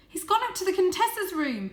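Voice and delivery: very high, loud; a last desperate shout; urgent shout. A narrator's reading. Varjak keeps warning his family, shouting high. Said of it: "shouted Varjak".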